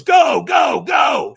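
high, loud, punched repeats; urgent shouted send-off. Retold or imitated. Trainer sending a firewalker off, urgent shout. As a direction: shout them off the start like a coach. Trainer sends him onto coals.

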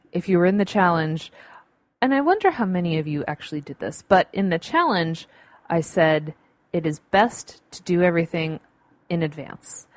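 ordinary talk, nothing marked

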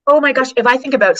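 high and loud burst of enthusiasm; light up at the idea; real excitement. Real, not acted. Excited reaction to a guest's point.

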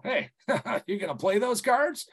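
teasing with a laugh in it; laughing needle. Shown as a demonstration. Poker player laughing as he repeats the taunt to Bob. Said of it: "a hundred and fifty different ways to say this line".

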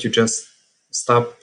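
ordinary talk

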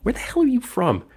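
a hint of a sneering Bill Murray grilling him; mock Bill Murray. Podcaster imitates Bill Murray grilling Janosz, mock high voice. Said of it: "He pulled it off so bad".

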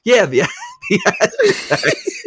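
high slow laughing reply. Retold or imitated. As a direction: giggle through the word. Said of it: "And he went".

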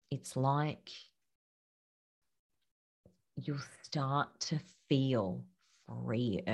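a gentle hushed confiding tone. Real, not acted. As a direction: speak low as if soothing a friend. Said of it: "I don't know why I'm whispering right now".

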